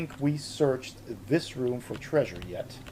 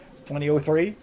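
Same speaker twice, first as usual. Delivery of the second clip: normal talking voice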